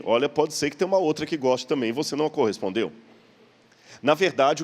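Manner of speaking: normal talking voice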